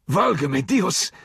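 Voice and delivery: high, slow, strangled gasp of terror; hanged old alcalde gasping to God in Spanish; gasped prayer. A narrator's reading. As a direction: choke it out while hanging. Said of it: "he gasped".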